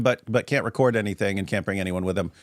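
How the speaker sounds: ordinary delivery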